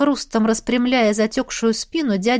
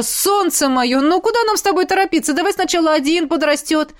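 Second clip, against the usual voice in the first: higher coaxing plea; pleading; 7 semitones higher than usual. Acted. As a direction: coax her, pleading. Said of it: "взмолился".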